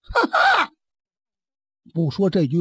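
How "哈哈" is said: sharp high burst of laughter. Acted. Storyteller mid-scene, a sudden high laugh.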